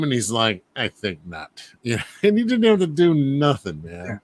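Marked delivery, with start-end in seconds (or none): 0.7-1.6 low, soft villain's brush-off; cool dismissal. Acted. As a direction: answer the challenge quietly and low. Villain's cool reply to challenger.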